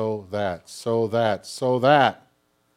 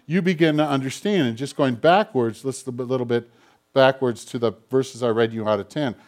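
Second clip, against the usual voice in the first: ordinary talk, nothing marked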